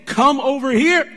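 mock church barker. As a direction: hawk the church like a fast-food ad. Mocking churches calling people in.

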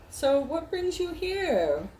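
high, slow camp voice; flamboyant shopkeeper. Acted. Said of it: "And he's like".

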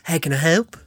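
put-on lightly done New Zealand accent lady. Mimicking a New Zealand voice assistant, mild Kiwi accent. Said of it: "a New Zealand, a lady".

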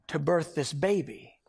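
normal talking voice

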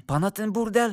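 usual speaking voice

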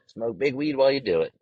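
usual speaking voice

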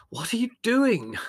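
high-pitched, slow exasperated demand; irritable. A narrator's reading. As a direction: ask it in irritation, pitch jumping well up. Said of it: "asked Larry irritably".

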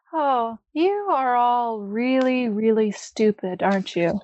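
slow, sneering contempt, lightly voiced; drawn-out scorn from the captive; mocking villain. Captive villain mocks the party.

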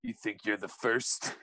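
low, slow, soft movie threat; grizzled tough-guy Santa, kept quiet; Mel Gibson. Acted. Fan quoting Fat Man to his co-host, low menacing impression. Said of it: "that was a good Mel Gibson as Fat Man".

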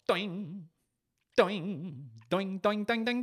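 cartoon boing; bouncing spring noise. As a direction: imitate a springboard bouncing, rising in pitch.

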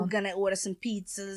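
put-on New York accent. Continuing the New York act, New York accent. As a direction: say it like a New Yorker. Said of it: "New York is the most famous accent".

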